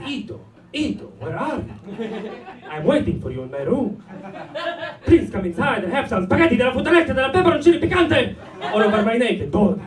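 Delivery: put-on sensual Italian accent. Friend's phone call as his monkey mind heard it, seductive Italian accent. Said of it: "all my monkey mind could hear".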